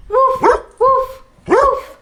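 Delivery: high, puffed woofs; dog bark. Pretend. Teacher asks children to bark along, high dog barks. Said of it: "Can you bark like a dog?".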